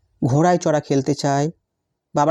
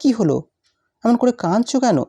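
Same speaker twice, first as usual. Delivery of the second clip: rising, startled asking, pitch higher; surprised question; 6 semitones higher than usual. Retold or imitated. Husband asks his tearful wife why she cries, surprised. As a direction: ask in surprise, voice going up. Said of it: "অবাক হয়ে জিজ্ঞেস করলাম".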